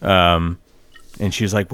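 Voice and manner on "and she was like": usual speaking voice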